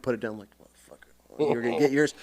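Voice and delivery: low, soft grumble under breath; muttering. Retold or imitated. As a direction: grumble it to yourself.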